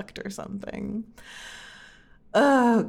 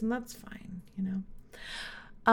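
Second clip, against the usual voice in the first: soft whisper; 8 dB quieter, much slower than usual. Real, not acted. Soft resigned aside.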